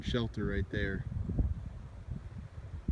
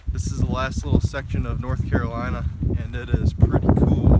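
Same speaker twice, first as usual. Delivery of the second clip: raised voice against strong wind; shouting over the wind; 9 dB louder than usual. Real, not acted. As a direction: push the voice over a gusty wind. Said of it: "you probably can't hear me with the wind".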